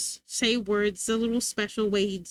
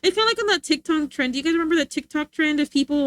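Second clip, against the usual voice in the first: ordinary talk, nothing marked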